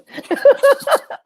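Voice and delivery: a high burst of real laughter. Real, not acted. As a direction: let a high laugh break out.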